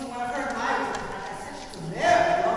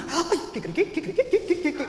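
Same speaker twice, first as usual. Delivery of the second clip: excitable crowing, rising in pitch; hyper rooster; 4 semitones higher, much faster than usual. Acted. Gallo spotting Señorita Martina, hyper rooster crowing. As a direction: crow in quick repeated bursts. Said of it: "hyper, lots, and I'm talking about".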